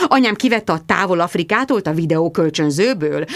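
ordinary delivery

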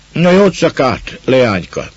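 gruff farewell. A narrator's reading. Man bidding the girl goodnight, loudly. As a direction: call the goodbye warmly but roughly.

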